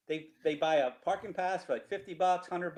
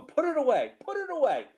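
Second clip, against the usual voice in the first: higher-pitched barked cop order; 11 semitones higher than usual. Retold or imitated. Cop yelling at the peeing kid, mock bark. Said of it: "I hear the cop going".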